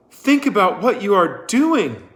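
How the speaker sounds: high, loud desperate plea; crying out. A narrator's reading. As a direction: call out to stop the crowd. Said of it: "cried Miss Catherine".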